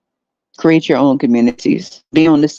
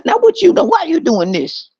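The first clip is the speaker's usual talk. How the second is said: higher mock pleading voice; 8 semitones higher than usual. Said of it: "And I said".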